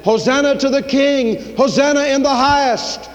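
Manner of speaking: high, ringing cheer; preacher crying out as the crowd; crowd acclamation. Retold or imitated. Crowd hailing the king.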